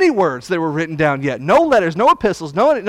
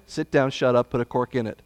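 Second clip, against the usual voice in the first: "sit down, shut up" said lower and softer, almost under the breath; understated command; 6 semitones lower, 6 dB quieter than usual. Acted. Preacher repeating the command to the congregation, low and quiet. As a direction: let the command sink in quietly.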